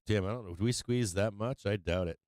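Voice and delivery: ordinary talk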